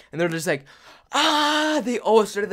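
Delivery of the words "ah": high, loud mock shriek; long, high-pitched pretend scream; imitated terrified scream. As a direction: imitate friends screaming in fright. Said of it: "screaming at the top of their lungs".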